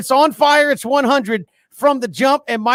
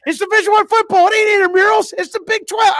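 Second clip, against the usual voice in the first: high, loud ranting coach; mock yell; 9 semitones higher, 4 dB louder than usual. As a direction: shout it off the reservation. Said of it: "It's like".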